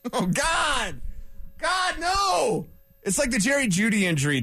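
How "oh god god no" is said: hammed-up cry of despair, much higher; mock anguish. Host hamming it up over the ruined Friday, mock anguished wail. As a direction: wail "God, no" like a lament.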